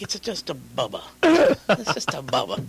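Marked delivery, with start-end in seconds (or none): 1.2-2.6 drawn out, louder and higher; slower hammed-up repeat. Retelling his answer about the plate, slow hammed-up insistence. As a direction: lean on it slowly, a bit goofy. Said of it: "I'm like".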